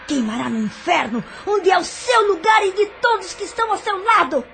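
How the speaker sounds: laughing through the curse, rising to a shout; gloating laughter. Acted. Villainess gloats over the condemned doctor, laughing and shouting. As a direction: cackle as you damn him. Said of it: "rindo com sarcasmo".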